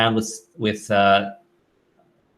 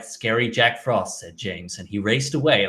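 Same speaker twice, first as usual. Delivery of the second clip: completely monotone narration, trailing softer; Alec Baldwin, monotonous; 4 dB quieter than usual. Retold or imitated. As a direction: imitate a bored, toneless narrator. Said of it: "in this completely monotonous voice".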